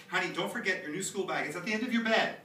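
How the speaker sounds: put-on calling to a child in another room. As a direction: raise the voice to reach upstairs.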